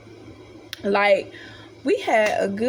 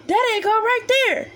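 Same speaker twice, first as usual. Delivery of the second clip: voiced distant neighbor, higher; imitating a stranger calling out, pitched up; overheard stranger; 11 semitones higher than usual. Narrator mimics a stranger heard nearby, higher voice.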